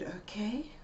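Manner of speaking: soft whisper; soothing whisper; whispered okay. Retold or imitated. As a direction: whisper it to calm her.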